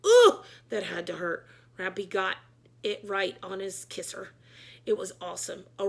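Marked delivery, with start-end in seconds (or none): 0.1-1.5 crying pained sound; mock wince. A narrator's reading. Narrator wincing at the crash, with a pained cry. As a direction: wince through the words.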